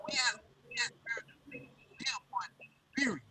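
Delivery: muffled, hand over the mic; muffled, like through a wall. Real, not acted. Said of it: "You sound muffled".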